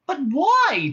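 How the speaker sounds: high, loud plea for reasons; demanding. A narrator's reading. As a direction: demand an answer, stretching it up high. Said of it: "demanded Trillian".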